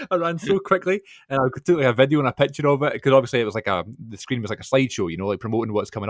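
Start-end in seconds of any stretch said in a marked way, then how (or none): 0.0-1.0 excited laugh. Real, not acted. Runs over excitedly.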